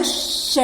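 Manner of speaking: a long voiceless श pushed through the teeth; sustained hissing sh demo; hissed sh sound. Shown as a demonstration. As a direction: hiss श to feel the warm air. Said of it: "हमारे मुंह में देखो".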